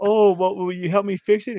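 very high, sugary mock woman's voice; S0 mimics the woman now wanting help, very high. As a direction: mimic her asking sweetly for help. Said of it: "And she's like".

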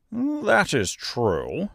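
high, very slow agreement; simple straw man, musing; thoughtful scarecrow. A narrator's reading. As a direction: draw it out as if pondering. Scarecrow considers her point.

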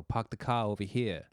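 R-dropping Boston accent; broad Massachusetts accent demo. Shown as a demonstration. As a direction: drop the R's like a Bostonian. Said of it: "the Boston, uh, Massachusetts, uh, kind of accent".

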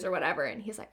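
ordinary delivery

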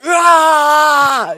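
high loud drawn-out yawn cry; yawning yell. Retold or imitated. Coworker yawning with whole body, loud yawn-yell. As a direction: stretch and bellow the yawn. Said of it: "full body yawn".

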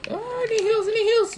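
pleading for heals, very high; begging teammates at a squeal; desperate high plea. Real, not acted.

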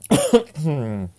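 harsh dry cough; clearing a tickly throat with a cough; coughing fit. Real, not acted. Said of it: "questa tosse oggi".

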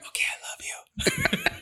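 weepy, whispered, slowed plea; mock-sob it out; tearful whisper. Pleading with his wife at one in the morning, mock tearful whisper.

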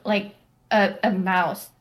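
ordinary talk

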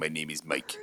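Russian-sounding take on a Bulgarian man; mock Bulgarian accent. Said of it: "He sounds Russian".

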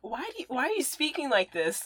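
high-pitched mock bafflement; baffled challenge. Cousin challenged over his put-on American accent, mock bafflement.

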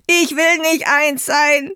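desperate scream. Acted. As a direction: scream it out in despair. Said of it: "schrie er voll Verzweiflung".

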